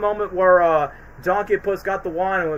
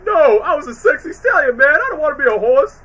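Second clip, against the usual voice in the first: higher, louder whiny protest; Donkey; 8 semitones higher, 6 dB louder than usual. Retold or imitated. Quoting Donkey complaining about being a horse. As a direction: whine it like a sulking donkey. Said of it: "Donkey's reaction by saying".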